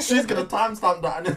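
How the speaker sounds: words bubbling out between laughs; laughing tease. Real, not acted. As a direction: tease while cracking up. Teasing friend amid shared laughter.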